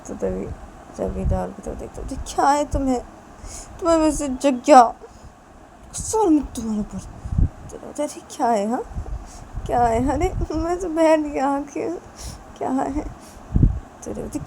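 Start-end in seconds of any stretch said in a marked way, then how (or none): 2.4-7.0 louder, annoyed scolding; irritated snap. Acted. Wife snaps irritably at her husband. 8.1-13.0 defensive bickering. Acted. Husband protests to his wife, exasperated.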